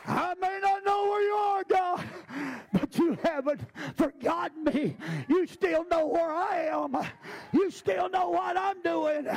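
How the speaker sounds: full-force sermon cry; preaching. Acted. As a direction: shout it to God before the church.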